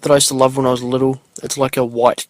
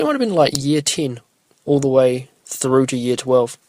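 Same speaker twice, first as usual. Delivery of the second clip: ordinary talk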